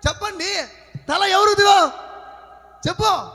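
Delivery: high, insistent sermon questioning; preacher's challenge. Real, not acted. Preacher quizzing listeners on daily habits, insistent preaching. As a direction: press the congregation for an answer.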